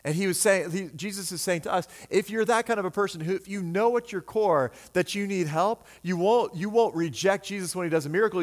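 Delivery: ordinary talk, nothing marked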